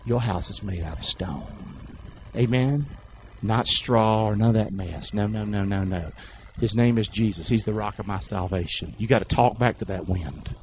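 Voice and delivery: low, soft, intimate assurance; hushed assuring preaching. Real, not acted. Preacher assures believers their house is stone, hushed preaching. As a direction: reassure them in a dropped voice.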